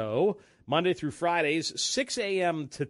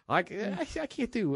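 ordinary talk

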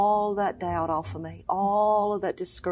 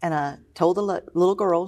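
ordinary talk, nothing marked

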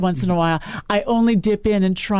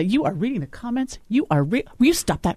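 raised pitch, each word pressed in mock reproach; higher-pitched mock telling-off; scolding husband; 5 semitones higher than usual. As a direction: imitate an exasperated spouse giving orders. Acting out husband scolding her.

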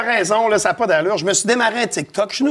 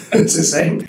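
laughing; slower than usual. Real, not acted. Laughing after a joke.

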